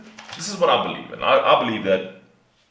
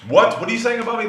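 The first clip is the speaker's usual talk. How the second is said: offended what; 11 semitones higher, 4 dB louder, faster than usual. Retold or imitated. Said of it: "They listen to".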